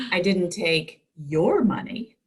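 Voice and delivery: slow read, "your" hit and falling; stress on your. Shown as a demonstration. Teacher reads the next bolded version, stress on "your". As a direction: weigh down "your", unhurried. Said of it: "Let's try the next one".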